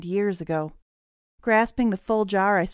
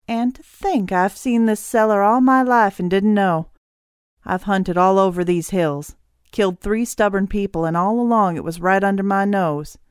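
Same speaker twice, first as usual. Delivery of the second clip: gloating; 2 semitones higher, 7 dB louder than usual. Acted. Villain gloats over his luck.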